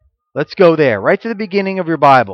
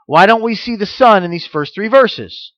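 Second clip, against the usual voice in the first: ordinary talk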